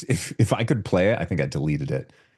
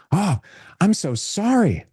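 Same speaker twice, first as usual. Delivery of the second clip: much higher, slow, sugary apology; high apologetic voice; 11 semitones higher, 4 dB louder than usual. Retold or imitated. Attorney apologizing on callback, voice jumping high and sweet. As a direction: flip from stern to overly sweet sorry. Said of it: "It's not deep voice anymore".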